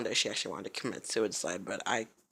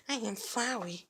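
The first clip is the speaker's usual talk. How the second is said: high, odd little flower voice; Flowey voice; 11 semitones higher, slower than usual. Shown as a demonstration. Vlogger demoing a game voice for viewers, high odd voice. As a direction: put on a high, creepy-cute voice. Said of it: "I don't know, Flowey".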